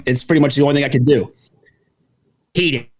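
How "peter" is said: a lightly voiced Lois calling Peter; soft, low Peter; Peter Griffin impression. Quietly saying Peter as his Peter Griffin bit. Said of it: "Peter Griffin impression".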